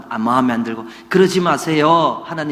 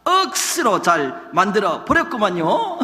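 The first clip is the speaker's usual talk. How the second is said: slow, higher-pitched mock Gyeongsang dialect; hammed-up Gyeongsang dialect; 6 semitones higher than usual. Shown as a demonstration. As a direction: swing into a drawn-out Gyeongsang lilt. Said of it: "경상도 말로 하면".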